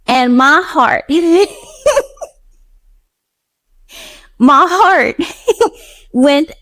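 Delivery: real laughter breaking through the words; moved to laughter remembering her generosity; laughing, overcome. Real, not acted. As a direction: laugh helplessly through the emotion. Overwhelmed by her kindness.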